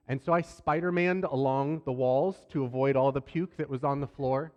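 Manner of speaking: normal talking voice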